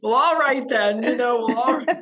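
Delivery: giggly all-right-then surrender; laughing mock resignation. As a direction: concede it with a laugh. Laughing acceptance of coach's advice.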